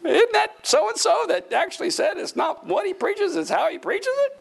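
high-pitched, teasing feigned wonder; mock innocent question. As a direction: ask it high, as if just recognising someone. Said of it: "and I was like".

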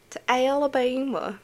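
put-on drawled Alabama accent on Alabama. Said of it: "Why'd you say it like that?".

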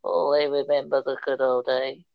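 a hint of a doddering grandad; old man voice. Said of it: "skeleton uncle ... going".

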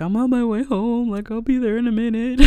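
high, mock-tearful voice; imitated crying voice. Crying woman telling her cousin she's coming, imitated tearful voice. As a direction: pitch it up as if talking through tears. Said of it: "she heard me crying".

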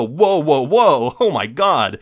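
acted-out shock; shocked reaction. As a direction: react aghast, as if putting on the brakes. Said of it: "And you go".

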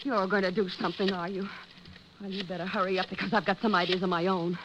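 wife threatening husband quietly; cold threat. Acted. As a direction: drop to a quiet warning.

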